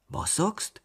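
high-pitched puzzled question; baffled. A narrator's reading. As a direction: ask as if you cannot believe your ears. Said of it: "starrte mich verblüfft an". (verblüfft nachgefragt)

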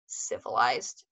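low, flat repeat; deep sneering repeat. Acted. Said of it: "Beatrice repeated".

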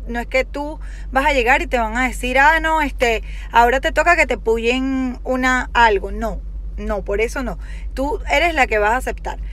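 ordinary delivery

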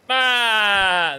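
one long, loud, high goat bleat; badly imitated goat, held in one long "aah"; attempted goat bleat. Shown as a demonstration. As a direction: bleat loudly like a goat on one drawn-out, falling note. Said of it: "I can't really do a goat sound".

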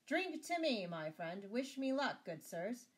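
high, loud triumphant exclamation; exclaiming. A narrator's reading. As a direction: call out jubilantly to the company. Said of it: "he exclaimed".

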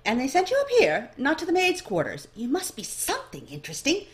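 bright curious clerk sizing up the newcomer; eager elf librarian. A narrator's reading.

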